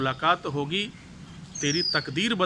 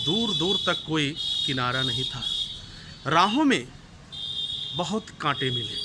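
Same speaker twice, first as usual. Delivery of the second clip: measured dramatic recitation with long pauses; poem recitation. Acted. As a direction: recite it like a stage poem to an audience. (नाटकीय कविता पाठ)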